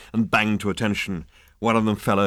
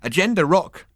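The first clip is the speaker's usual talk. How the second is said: happy trill; 10 semitones higher, 5 dB louder than usual. A narrator's reading. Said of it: "trilled the hairdresser happily".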